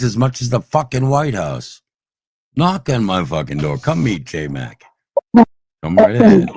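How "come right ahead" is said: high, loud shouted challenge; loud dare. Real, not acted. Daring the trolls to knock on his door, shouting. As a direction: yell the invitation as a threat.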